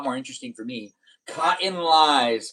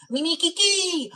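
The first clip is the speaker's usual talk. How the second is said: high singsong name; slower than usual. Acted.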